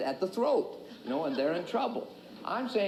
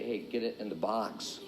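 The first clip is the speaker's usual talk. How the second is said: low, soft, understated advice; deadpan answer; 10 semitones lower, 5 dB quieter than usual. Acted. Coach answering eager beginners, deadpan. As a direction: say it low and offhand.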